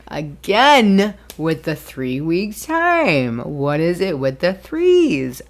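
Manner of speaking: loud, drawn-out mock exasperation; big exclaimed complaint, half joking; exasperated. Real, not acted. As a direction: stretch it out loud and slow, baffled. Exasperated joke about recurring threes.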